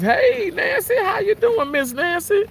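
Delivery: very high put-on lady's voice with a sweet twang; falsetto lady voice. Reading a viewer's chat greeting, very high lady voice. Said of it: "Miss Danny J says".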